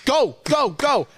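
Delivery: loud, high-pitched coaching yell; urgent coach shout. Retold or imitated. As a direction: push the player back into the drill. Coach pulling him back in.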